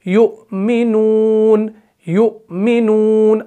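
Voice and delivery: very slow युमिनून, drawn out and recited; slow recitation. Shown as a demonstration. Teacher recites युमिनून for the students. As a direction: recite युमिनून slowly, like Quran reading. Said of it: "हम पढ़ते हैं युमिनून".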